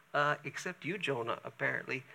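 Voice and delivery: ordinary talk, nothing marked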